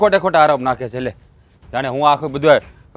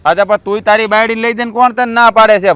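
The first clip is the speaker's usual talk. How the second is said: raised voice; heated challenge; angry retort; 4 semitones higher, 4 dB louder than usual. Acted. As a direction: push back hard at the brother. Brothers quarrel over money.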